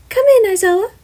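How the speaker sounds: high, bright-voiced principal; Nezu voice. Nezu asking Gem about housing, high character voice. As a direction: lift the pitch way up for the chimera principal.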